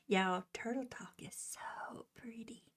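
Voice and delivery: soft whispered compliment; hushed, fond whisper. Real, not acted. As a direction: share it in a whisper.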